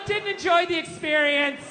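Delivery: bad-review parody. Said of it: "bad reviews on the fucking husband Yelp".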